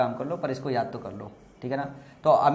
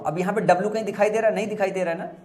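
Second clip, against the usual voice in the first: ordinary talk, nothing marked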